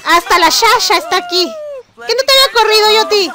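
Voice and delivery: high, loud, fast mock scolding; mock outraged scolding. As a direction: throw the guest out, hammed up. Voicing Sim scolding unwanted guest. (regaño fingido, agudo y fuerte)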